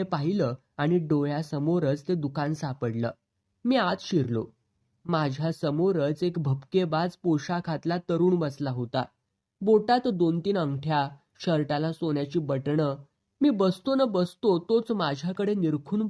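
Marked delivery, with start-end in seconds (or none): none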